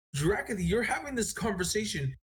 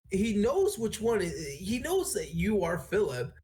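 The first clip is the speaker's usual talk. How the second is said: usual speaking voice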